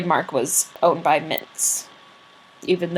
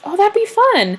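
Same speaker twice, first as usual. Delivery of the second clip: chirpy wife; 12 semitones higher than usual. Retold or imitated. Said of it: "And she goes".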